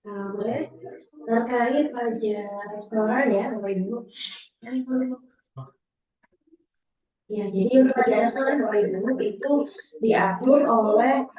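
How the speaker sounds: echoey, repeating sound on the line; echoing. Real, not acted. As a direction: voice echoing over the call line. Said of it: "Suaranya menggema lagi".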